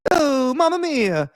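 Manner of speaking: high, drawn-out mock Italian wail; Jared Leto's fake Italian. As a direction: ham up a terrible Italian accent, slowly. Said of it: "Jared Leto in that fucking Gucci movie where he was like".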